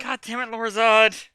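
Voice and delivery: exasperated outburst. Real, not acted. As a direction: burst out in exasperation at a friend. Losing composure at game scene.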